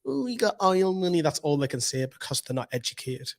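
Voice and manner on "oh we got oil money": jeering put-on voice; mocking rival fans. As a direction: mimic jealous rival fans in a higher voice. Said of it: "'cause they're going".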